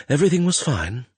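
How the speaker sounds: high, anxious self-reassurance; reassuring himself. A narrator's reading. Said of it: "he told himself".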